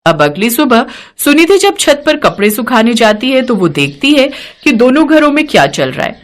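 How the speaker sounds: usual speaking voice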